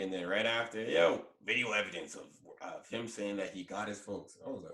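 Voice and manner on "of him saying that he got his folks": normal talking voice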